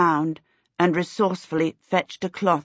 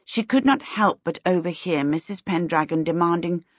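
ordinary delivery, nothing marked

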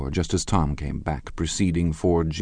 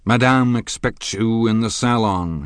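raised, louder announcing call; cried out; 6 semitones higher, 7 dB louder than usual. A narrator's reading. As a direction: call it out to the guests. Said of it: "he cried".

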